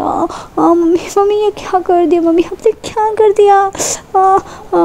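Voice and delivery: put-on a girl yelping as hot tea burns her; mock screaming in pain. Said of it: "एकदम से चिल्लाने लग जाती है". (गरम चाय से जलकर चिल्लाती बच्ची, ऊँची आवाज़ में)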